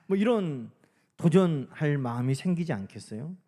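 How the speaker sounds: ordinary delivery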